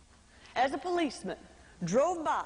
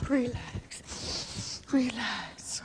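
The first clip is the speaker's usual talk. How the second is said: whispered relax; 3 semitones lower, much slower than usual. Retold or imitated. Deep breathing to calm down.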